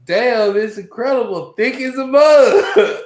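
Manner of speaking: high, loud excited exclamation; hyped up friend. Retold or imitated. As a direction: shout it like a rowdy college kid. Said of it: "First thing we say".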